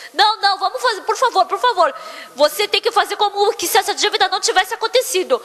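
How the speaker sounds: high-pitched insistent begging; acted-out desperate plea; imploring. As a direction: plead urgently, refusing her answer. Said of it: "porque ele implorou tanto".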